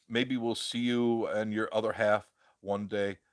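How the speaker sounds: ordinary delivery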